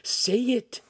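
usual speaking voice

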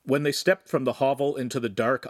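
ordinary delivery

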